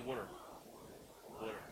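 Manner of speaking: "Water." as wooder, rounded oo vowel; Philly wooder. Shown as a demonstration. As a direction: say it like a Philly local. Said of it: "we say".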